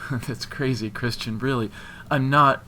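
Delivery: scared denial. A narrator's reading. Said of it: "because she was scared".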